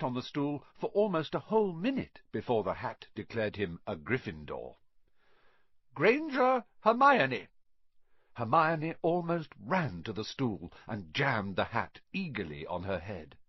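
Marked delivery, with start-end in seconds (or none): 5.9-7.5 high, slow, loud roll call; calling out a name. Acted. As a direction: draw the name out for the whole hall. Teacher calls out a name.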